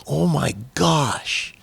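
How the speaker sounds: slow, drawn-out retold shock; stunned dismay. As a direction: stretch each word in appalled amazement. Retold stunned reaction to confession.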